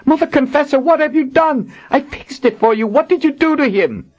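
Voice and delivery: high, urgent woman warrior; a distraught guard pleading high; Kara. Acted. Kara voiced in the story.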